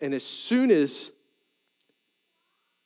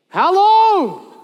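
much higher and louder, fed-up wail; exasperated cry; 10 dB louder than usual. Acted. Preacher mimicking a fed-up parent, loud exasperated wail. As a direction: throw it up to the ceiling, at wits' end. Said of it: "You got any of those people in your life?".

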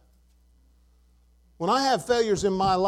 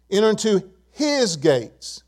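slow down and set his apart; his drawn out after a small pause; stress on his; slower than usual. Shown as a demonstration. Preacher reading the psalm to congregation, his emphasized. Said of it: "I'm gonna emphasize the word his".

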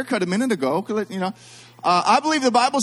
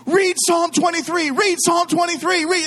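high-pitched, urgent mock yell; 10 semitones higher than usual. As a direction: demand it as if dying, hammed up. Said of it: "I'm yelling to my sister".

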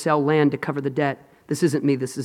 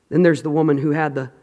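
usual speaking voice

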